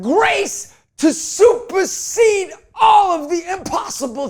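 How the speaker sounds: high, loud, slow preacher's cry stretching every word; preaching at full force. Acted. Preacher lifting the congregation about faith, loud and slow. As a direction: thunder it out from the pulpit, drawn out.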